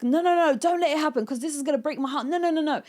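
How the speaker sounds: high, loud, panicked "no, no, no"; desperate pleading. Acted. Pleading to stop the cheating.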